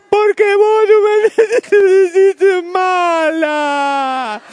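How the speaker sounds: put-on very high, loud, slow whining kid; whiny child. Child voice whines at mom calling her mean. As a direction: drag it out like a sulking child.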